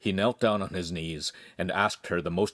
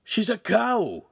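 high, drawn-out disgusted protest; whining, aghast insult; appalled; 14 semitones higher, 4 dB louder, slower than usual. A narrator's reading. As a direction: object with horrified scorn. Appalled suitor insults proposed bride.

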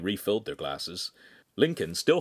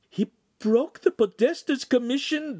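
much higher, alarmed disbelieving cry; cried out in dismay; 5 dB louder than usual. Acted. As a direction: gasp it out in horror. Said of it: "the Signora cried".

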